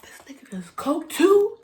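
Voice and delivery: hushed shocked aside, pitch up; shocked gasp. Retold or imitated. Mom hearing neighbor's secret, gasping whisper. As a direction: gasp then whisper in disbelief. Shocked reaction to coke news.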